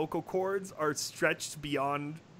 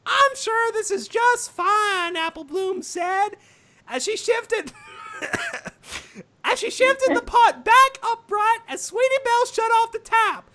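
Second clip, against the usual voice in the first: lightly raised narrator voice for ponies; high storytelling voice; 7 dB louder than usual. Acted. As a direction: read it a bit higher and bright.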